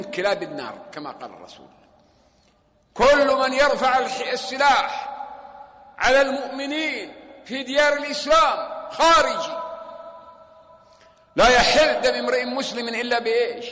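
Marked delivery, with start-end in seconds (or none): 2.9-13.7 fiery preaching. Real, not acted. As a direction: preach it hard at the crowd. Preaching at full force. (وعظ حماسي بصوت عال)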